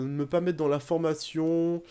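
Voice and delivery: ordinary talk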